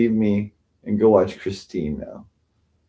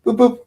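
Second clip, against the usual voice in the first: high-pitched boop boop; short, high imitated beeps; imitated chat alert; 3 dB louder than usual. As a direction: mimic a notification chime, way up high. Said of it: "But I will hear".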